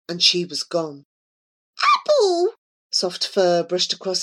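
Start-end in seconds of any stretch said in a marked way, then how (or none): 1.8-2.5 very high, excited call; small creature calling. A narrator's reading. Miss Gory calls out, high voice.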